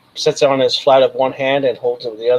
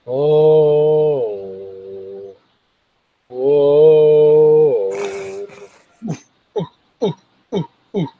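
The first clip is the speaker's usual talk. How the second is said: cooing alien crowd; 3 semitones higher, much slower than usual. Acted. GM voices the cooing bims crowd.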